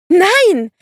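very high, loud scream. A narrator's reading. The narrator screaming as Delia throws herself in front: high loud scream. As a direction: scream it in horror. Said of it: "brüllte ich".

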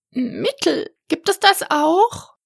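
hohe, helle Drachenstimme, ängstlich; kleiner Drache. A narrator's reading. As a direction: spricht als kleiner, verzagter Drache. (hohe Stimme des kleinen Drachen, der Max seine Angst gesteht)

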